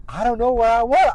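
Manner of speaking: put-on high, loud, goofy voice of a confused teen; hammed-up befuddled whine; mock tipsy kid. Tipsy J J acted in retelling.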